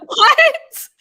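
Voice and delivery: very high, loud incredulous blurt; mock disbelief. Stunned listener reacting to casual name-dropping, loud mock disbelief.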